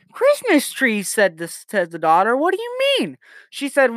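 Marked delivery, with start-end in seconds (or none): 0.1-1.1 high-pitched mock disbelief; incredulous echo. Daughter's startled echo in joke. 2.4-3.2 very high-pitched acted question; baffled daughter. Baffled daughter questions mom in joke.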